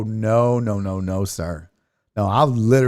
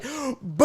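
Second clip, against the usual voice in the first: boo; 14 semitones higher, 3 dB quieter than usual. Retold or imitated. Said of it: "I'm like".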